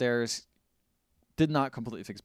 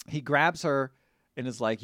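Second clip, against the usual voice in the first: ordinary talk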